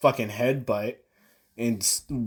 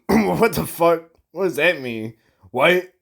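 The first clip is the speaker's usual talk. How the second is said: retold disbelief, pitched up and louder; baffled outburst; 7 semitones higher, 6 dB louder than usual. Gamer reliving a boss fight's parry confusion, baffled. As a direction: act out exasperated confusion, higher and louder.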